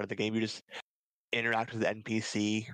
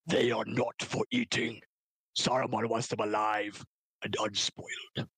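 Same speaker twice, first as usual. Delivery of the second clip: orc leader giving orders; stern movie orc, slowing at the end. Acted. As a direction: lay down the law as an orc. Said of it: "I can do a really good orc".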